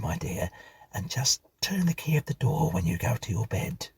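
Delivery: a motherly housekeeper confiding a warning; old housekeeper. Acted. Mrs Bunch cautions Stephen about the marks, homely housekeeper voice.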